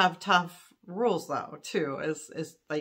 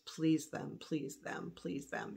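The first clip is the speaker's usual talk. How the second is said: inner voice; 2 semitones lower, 11 dB quieter, slower than usual. Retold or imitated. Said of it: "something in the back of my mind that goes".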